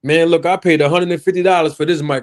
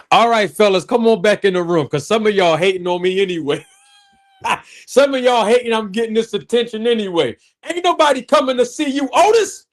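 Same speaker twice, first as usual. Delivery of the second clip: mocking; 8 semitones higher than usual. Mocking the fellas after ladies bit.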